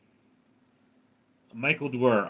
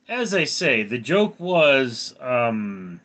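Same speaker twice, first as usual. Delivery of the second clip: ordinary delivery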